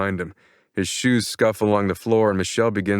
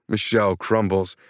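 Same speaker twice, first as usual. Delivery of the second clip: usual speaking voice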